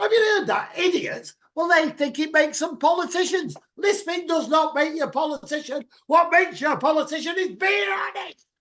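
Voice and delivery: high, loud ranting shout; exasperated shouted tirade; indignant rant. Real, not acted. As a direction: hammer it out, outraged. Rant at lisping politicians peaks.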